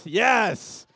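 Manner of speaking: loud, high gloating cheer; triumphant yes. Real, not acted. Pastor crowing loudly as players get caught out. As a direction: crow over catching them out.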